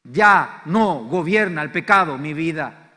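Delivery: slow, loud, word-by-word "Ya no gobierna el pecado mi vida"; slow declaration. Shown as a demonstration. Preacher giving the congregation a line to echo, slow and loud. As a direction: lay out "Ya no gobierna el pecado mi vida" slowly for the crowd to repeat. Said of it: "Decí conmigo".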